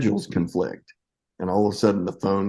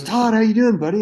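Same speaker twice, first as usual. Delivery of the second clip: hearty cheerful greeting; 13 semitones higher, 5 dB louder, faster than usual. Retold or imitated. Director answers phone cheerfully.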